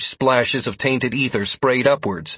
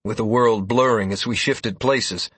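ordinary talk, nothing marked

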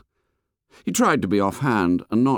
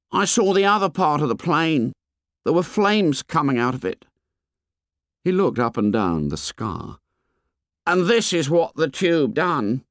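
put-on fat boy voice; 8 semitones higher than usual. A narrator's reading. Fat boy complains to the fair boy, high and whiny. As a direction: complain like a chubby schoolboy.

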